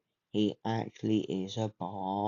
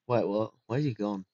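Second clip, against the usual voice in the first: ordinary delivery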